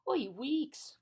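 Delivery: high, loud weary wail; exasperated. A narrator's reading. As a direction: moan it out dramatically. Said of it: "continued the bug".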